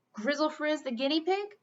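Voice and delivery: ordinary delivery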